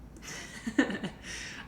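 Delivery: quiet airy laugh; soft airy laugh that falls away; breathless laugh. Real, not acted. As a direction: laugh under your breath. Laughing at British joke.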